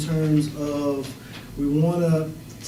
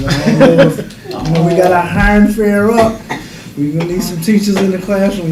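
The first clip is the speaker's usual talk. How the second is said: louder, projecting to the whole room; teacher voice; 3 semitones higher, 6 dB louder than usual. Shown as a demonstration. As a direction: raise the voice like a teacher quieting a class. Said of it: "I'm about to elevate it right now".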